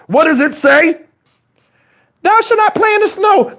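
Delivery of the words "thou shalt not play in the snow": high, solemn preacher read; mock scripture reading. Reading the Bible verse to Shannon, high and solemn. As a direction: intone it like holy writ.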